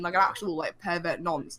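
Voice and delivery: ordinary talk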